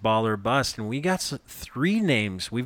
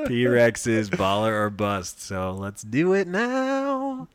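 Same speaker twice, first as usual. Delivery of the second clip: drawn-out announcement; slower than usual. Acted. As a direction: stretch it out like a show intro. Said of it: "Let's jump into".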